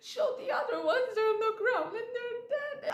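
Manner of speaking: half-laughing, half-sobbing through the words; retold bawling. Remembering how she bawled at the movie, crying and laughing. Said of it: "like, bawling".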